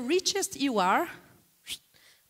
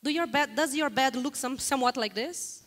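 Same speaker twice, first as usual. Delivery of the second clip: ordinary talk, nothing marked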